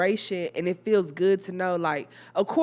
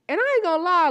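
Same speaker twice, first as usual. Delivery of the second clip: excited outburst; 12 semitones higher, 6 dB louder, faster than usual. Real, not acted. Excited point about the kids.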